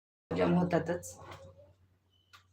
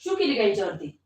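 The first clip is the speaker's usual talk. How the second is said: much higher in real surprise; shocked question; 9 semitones higher than usual. Real, not acted. Toddler peed on the floor. (मुलीने शू केली म्हणून आश्चर्याने उंच आवाजात विचारणे)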